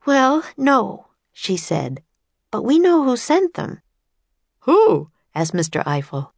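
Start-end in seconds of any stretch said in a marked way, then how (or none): 0.1-3.8 put-on high, eager little girl's voice; bright young girl. A narrator's reading. Annie introducing herself and Jack to famous inventors, eager girl voice. 4.7-5.1 startled who. A narrator's reading. Eiffel asks sharply.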